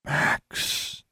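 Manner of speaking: voiceless, hushed repeat of the name; very soft whisper. A narrator's reading. Girl repeating her call to a ghost, very softly whispered. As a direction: breathe it out very softly, no voice. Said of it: "she repeated very softly".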